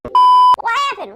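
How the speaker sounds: slow, high, loud cry of alarm; panicked shout. Acted. Shrunken Carl shouts in panic, high and loud. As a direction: yell it out in shock.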